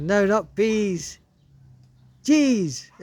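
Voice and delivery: slow, high, loud shout; shouting for a deaf man. Retold or imitated. Boy shouting his order at the deaf postmaster. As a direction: yell each word slowly so he hears. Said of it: "you had to shout at the top of your voice".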